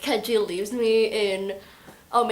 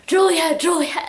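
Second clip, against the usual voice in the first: higher, louder urgent call; acted calling out; 6 semitones higher, 5 dB louder than usual. Warner shows up calling for Juliet, acted urgent call. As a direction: call the name out twice, pitched up.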